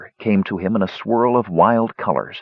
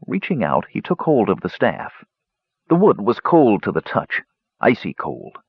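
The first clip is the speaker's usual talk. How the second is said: ordinary delivery, nothing marked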